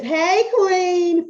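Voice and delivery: high, loud, eager hey queen; eager greeting. Retold or imitated. Client greeting coach on live video, eagerly high and loud. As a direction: gush the greeting like a fan. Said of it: "she says".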